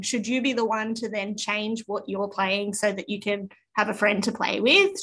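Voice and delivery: usual speaking voice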